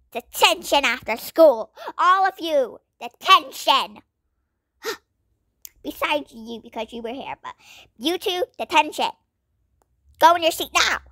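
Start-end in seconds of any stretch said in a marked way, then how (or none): 0.1-4.0 loud, stern teacher handing out detention; strict teacher. Acted. 8.0-11.0 loud, stern teacher handing out detention; strict teacher. Acted.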